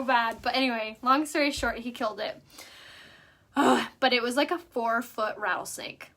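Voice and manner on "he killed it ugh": normal talking voice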